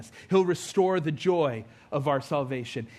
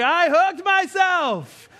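loud, high, drawn-out wail; slow mock-hysterical yell of a hurt child; reenacted scream; 13 semitones higher, 8 dB louder, much slower than usual. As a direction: cry out at full pitch, stretching the words. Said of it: "I screamed".

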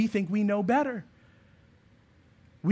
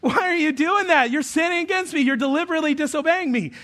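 exasperated dad; 6 semitones higher than usual. Retold or imitated. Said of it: "And I'm like".

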